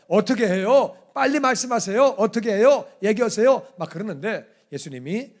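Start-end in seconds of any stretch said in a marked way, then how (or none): 0.1-3.7 loud, pushy, higher-pitched crowd demand; shouting at the teacher to answer now. Retold or imitated. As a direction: press him hard for an answer. Crowd pressing Jesus for verdict.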